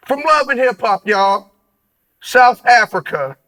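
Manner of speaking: hyped guest introduction; hype announcer call. Acted. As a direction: build to the guest's name like a boxing announcer.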